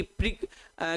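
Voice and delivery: ordinary talk, nothing marked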